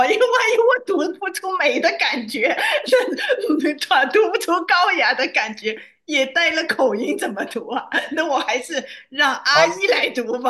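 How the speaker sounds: real laughter breaking through the words; laughing. Real, not acted. Reader shyly begging off reading French, laughing.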